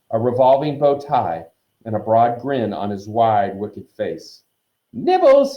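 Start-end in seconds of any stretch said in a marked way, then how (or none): 4.9-5.6 high, loud sugary singsong; mock-sweet poltergeist, pitched way up; sweetly. A narrator's reading. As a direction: offer it with exaggerated sweetness. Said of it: "he said sweetly".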